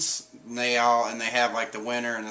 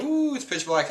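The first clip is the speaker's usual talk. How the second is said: high, awed gasp; be wowed by the dark; awed crew; 9 semitones higher than usual. Retold or imitated. Crew peering down into the dark, high awe. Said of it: "everybody's like".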